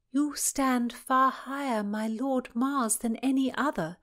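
high, slow, ardent recital; love-letter reading. A narrator's reading. Constance reading an ardent letter aloud, high recital. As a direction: read it out high and lingering.

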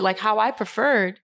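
ordinary delivery

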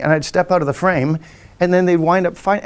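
ordinary talk

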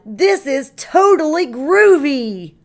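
high, loud, delighted shout; excited cry. Acted. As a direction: whoop it out mid-flight. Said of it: "Pete the Cat cried".

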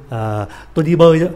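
ordinary talk

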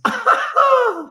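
sudden high-pitched laugh; high squeal of laughter. Real, not acted. Laughs at own milligrams joke.

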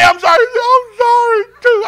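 half-sobbing, loud apology retold; tearful apology. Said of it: "I say".

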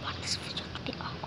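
hushed whisper, barely voiced. Real, not acted. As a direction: whisper so nobody hears. Whispering while playing a game.

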